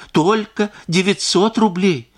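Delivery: рублей drawn out slowly and high; pleading emphasis. A narrator's reading. Wife stressing the small sum she needs. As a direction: press the sum home slowly.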